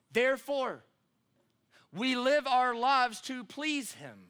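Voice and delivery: high, emphatic, slow pulpit delivery; measured, ringing preacher's proclamation; full-force preaching. Real, not acted. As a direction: drive each contrast home, leaning on the key words.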